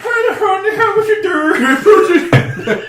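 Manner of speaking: very high, loud mock-Swedish warble; Swedish Chef. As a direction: hoot it out in cartoon Swedish. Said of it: "there is a Swedish Chef slash fiction".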